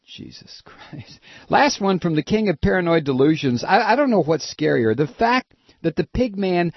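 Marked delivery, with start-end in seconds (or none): 0.1-1.2 soft, breathy exasperated whisper; whispered disbelief. Real, not acted. Aside of disbelief.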